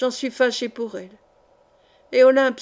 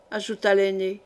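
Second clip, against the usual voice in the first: plain reading voice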